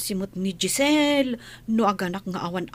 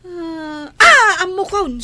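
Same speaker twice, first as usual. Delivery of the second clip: startled cry; 8 semitones higher, 10 dB louder, slower than usual. Acted. Startled maid caught off guard.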